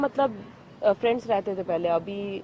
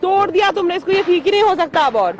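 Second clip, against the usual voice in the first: high, loud angry complaint; upset accusation; 6 semitones higher, 6 dB louder than usual. Real, not acted. Woman complains her glasses cannot be fixed, angry. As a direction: blame him for damage beyond repair.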